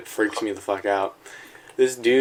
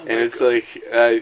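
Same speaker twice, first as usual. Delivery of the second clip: ordinary talk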